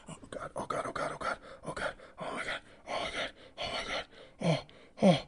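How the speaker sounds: whispered, panting "oh God" over and over; mock breathless panting. In his fantasy scene, gasping breathlessly in her arms. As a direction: pant the words out in a hushed gasp.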